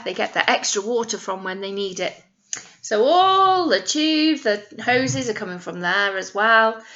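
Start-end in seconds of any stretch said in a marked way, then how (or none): none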